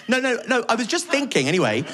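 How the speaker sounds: a mum easing into a dark question; hesitant chirpy mum. Retold or imitated. Mum on the phone, awkwardly cheerful.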